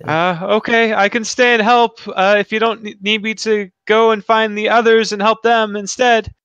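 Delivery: high-pitched, earnest character voice; eager young hero. Acted. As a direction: offer help in a high voice.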